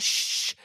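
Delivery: long voiceless shush. Retold or imitated. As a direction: hush him like the old lady. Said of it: "she's like".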